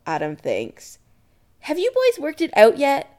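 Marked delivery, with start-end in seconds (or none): 1.6-3.1 high, loud motherly call. Acted. Kim to the boys from the kitchen, calling. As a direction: call it from the next room. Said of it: "Kim calls from the kitchen".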